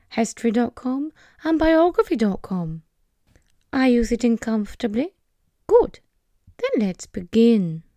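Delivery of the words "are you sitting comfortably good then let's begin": storyteller voice. Acted. Storyteller opening.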